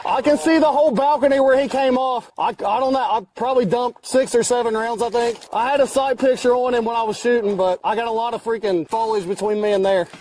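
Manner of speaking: high, keyed-up voice after firefight; adrenaline rush. Real, not acted. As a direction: talk high and wired on adrenaline.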